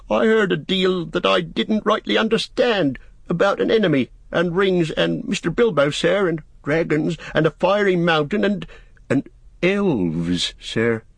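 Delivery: high, faltering list of excuses; dithering. A narrator's reading. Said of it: "dithering a little".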